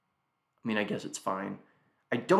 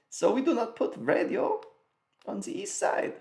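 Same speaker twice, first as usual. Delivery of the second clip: put-on German accent; 12 semitones higher than usual. Mock German joke continues.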